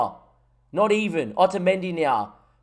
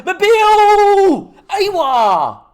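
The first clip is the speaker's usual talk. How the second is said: long, stretched, much higher and louder yell of the name; goal celebration yell; 10 dB louder, much slower than usual. Real, not acted. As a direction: scream the scorer's name, drawing it out. Goal celebration, yelling scorer's name.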